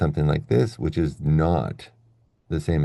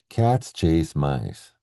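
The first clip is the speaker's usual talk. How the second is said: slow, evenly weighted "Cats", "chase", "mice"; very slow reading; much slower than usual. Shown as a demonstration. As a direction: draw out "Cats chase mice" with long stressed words. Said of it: "So slow, so slow".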